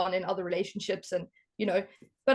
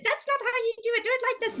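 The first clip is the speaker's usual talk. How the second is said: much higher, fast nagging mother voice; mock the mother barging in, pitched way up; bossy mom; 12 semitones higher, much faster than usual. Mother cutting in on the dishwasher loading, high and quick. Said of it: "And Miss Ma'am comes and said".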